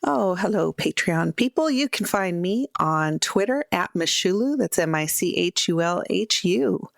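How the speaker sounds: usual speaking voice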